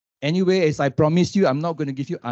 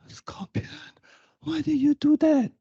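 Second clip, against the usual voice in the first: breathy start then a much higher cry of why; betrayed frog; 12 semitones higher than usual. Acted. The stung frog cries out to the scorpion, shocked and betrayed.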